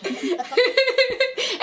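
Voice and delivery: high-pitched giggling; real giggle. Real, not acted. Amused at friend's teasing about invitations, giggling. Laughs after friend's joking remark.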